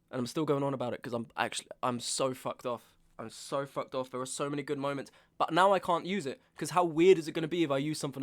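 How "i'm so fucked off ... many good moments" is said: normal talking voice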